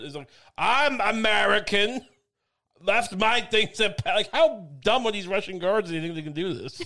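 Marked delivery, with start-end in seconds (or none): 0.6-4.1 slow, higher-pitched mock American accent. Comedian mocking protesters posing as Americans, exaggerated American accent. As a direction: put on a laboured, drawn-out American accent. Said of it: "with a believable American accent".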